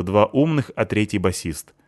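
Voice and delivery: plain reading voice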